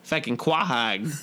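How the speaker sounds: quahog with broad Rhode Island vowels, said as "kwah-hog"; Rhode Island accent. Retold or imitated. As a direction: imitate a Rhode Island local saying "quahog". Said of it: "He said quahog".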